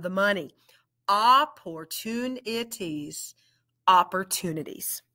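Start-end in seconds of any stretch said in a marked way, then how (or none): 1.1-3.3 "Opportunities" said slowly, syllable by syllable; segmented "Opportunities"; slow, split into syllables. Shown as a demonstration. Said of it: "We're gonna blend it". 3.9-5.0 "Opportunities" said fast, all in one piece; quick, blended "Opportunities". Shown as a demonstration. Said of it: "We're gonna blend it".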